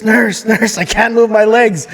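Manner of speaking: high-pitched mock yell for help; retold yelling. Patient retelling a yell for the nurse, high mock panic. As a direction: call out urgently, as if panicking. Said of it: "I'm yelling for the nurse".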